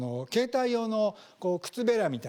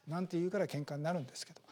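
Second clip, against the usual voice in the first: normal talking voice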